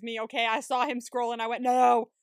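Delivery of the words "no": loud, drawn-out protest; mock dismayed cry. Viewer seeing him scroll the pictures, loud dismayed cry. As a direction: cry out at a dreaded plot turn. Retold horrified reaction to scrolling.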